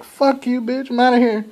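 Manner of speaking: very high mock yell at the attacker; high-pitched hammed-up girlfriend telling him off; angry friend. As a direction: squeal it like a fed-up girl storming off. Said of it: "She's like".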